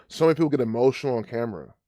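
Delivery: usual speaking voice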